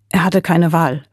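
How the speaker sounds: ordinary delivery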